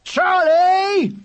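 high, loud exasperated shout; shrieked reprimand to a brother; crying out. A narrator's reading. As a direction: yell the name in outrage. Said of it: "cried William".

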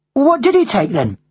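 high schoolboy voice; young boy. Acted. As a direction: press your mother for the answer. Boy questions his mother.

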